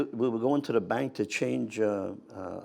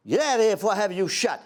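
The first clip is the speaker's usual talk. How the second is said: louder, higher angry command; shouted order; 8 semitones higher, 6 dB louder, faster than usual. Retold or imitated. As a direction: order him out. Major ordering soldier out.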